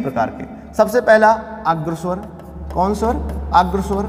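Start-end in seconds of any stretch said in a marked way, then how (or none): none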